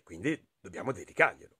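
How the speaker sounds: ordinary delivery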